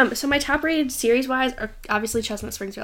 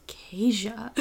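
hushed warning whisper; whispered name. Retold or imitated. Boyfriend's scolding quote.

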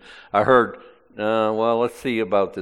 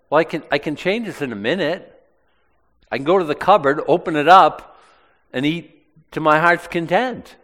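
higher and louder, breezy imitated boast; mock casual confidence; 6 semitones higher, 5 dB louder than usual. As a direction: play the comfortable person who can eat any time. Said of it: "it's always like".

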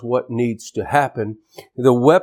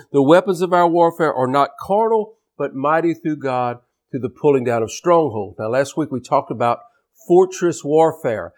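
normal talking voice

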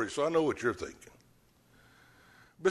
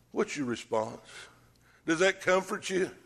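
ordinary talk, nothing marked

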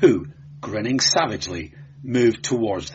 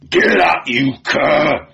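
loud, raised commanding roar; 6 semitones higher, 8 dB louder, slower than usual. A narrator's reading. Guardsman to the beaten clerk, roaring. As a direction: roar it at a man on the floor. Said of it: "roared Postumo".

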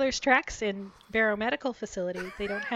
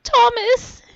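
loud, high wailing sob of the name; sobbing cry; 14 dB louder than usual. Acted. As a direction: break down crying out his name. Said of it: "she sobbed".

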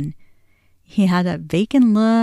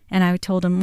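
usual speaking voice

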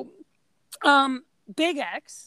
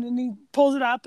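ordinary delivery

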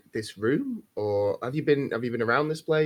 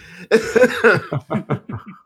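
a big real laugh; laughing at his own joke; hearty laugh; 5 semitones higher, 6 dB louder than usual. Real, not acted. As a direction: burst out laughing. Player laughs at own line.

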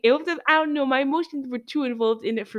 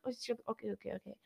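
dropped to a near mumble, giving in; soft startled backing down; meek mumble; 16 dB quieter, much faster than usual. Retold or imitated. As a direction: mutter it, cowed and backing off. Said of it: "He's like".

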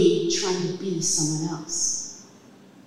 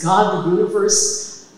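ordinary talk

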